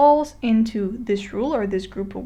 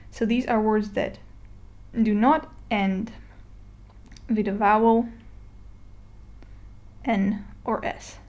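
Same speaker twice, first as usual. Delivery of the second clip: ordinary talk, nothing marked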